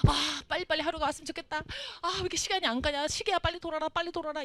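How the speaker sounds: high-pitched, eager and impatient; impatient eager child. Retold or imitated. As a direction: whine for time to hurry up like a restless kid. Impatient son counting days. (들뜬 조바심)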